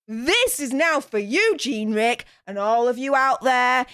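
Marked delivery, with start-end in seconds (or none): none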